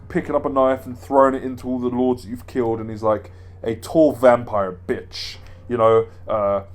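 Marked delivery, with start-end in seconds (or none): 3.6-5.4 slow, drawling mock villain sneer; Heisenberg impression. Heisenberg taunting the dead lords, slow mock sneer. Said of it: "he talks like this".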